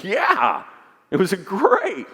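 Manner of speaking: buddy's answer. Retold or imitated. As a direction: imitate the buddy's upbeat answer lightly. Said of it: "And he goes".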